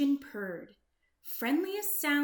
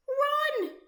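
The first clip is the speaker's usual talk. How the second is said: very high, louder alarm shout; panicked cry; 6 dB louder than usual. Acted. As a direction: scream a warning. Goose panics.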